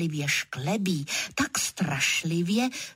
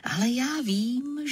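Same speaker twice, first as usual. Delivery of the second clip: usual speaking voice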